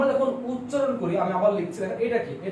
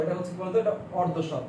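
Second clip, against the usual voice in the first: normal talking voice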